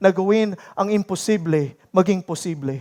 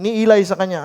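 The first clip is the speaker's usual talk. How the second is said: normal talking voice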